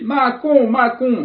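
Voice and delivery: high, loud hammed-up Italian cook shouting Macon; Italian chef accent. Chef bursting from the kitchen, loud Italian accent. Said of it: "Bon, il est italien".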